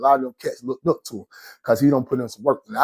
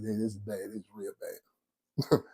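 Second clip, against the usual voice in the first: low dismayed murmur; 5 semitones lower, 14 dB quieter, slower than usual. Retold or imitated. Repeats the dismayed line.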